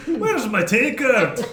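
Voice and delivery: slow, drawn-out Scottish demand; Scottish accent. Acted. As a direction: bellow it in a Scottish brogue. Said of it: "As this infernal dude ... Scottish walks up".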